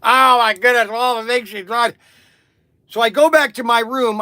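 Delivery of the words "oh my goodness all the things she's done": loud mock-drunk lament; imitated boozy grieving, loud; half-cocked drunk. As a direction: wail like a maudlin drunk. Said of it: "I'm half, I'm half cocked".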